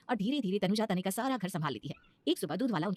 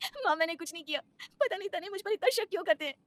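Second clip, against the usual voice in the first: much higher, weeping plea; crying; 10 semitones higher than usual. Acted. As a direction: sob it out to the mother-in-law. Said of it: "Tanuja Savitri के गले लगकर बहुत रोती है". (बहुत ऊँची, रोती हुई फरियाद)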